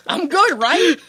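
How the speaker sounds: loud, high mock boast; hammed-up needy brag; cocky imitation. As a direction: crow it, mocking his self-satisfaction. Mocking replay of Billy's cocky line.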